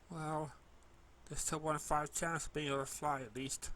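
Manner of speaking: usual speaking voice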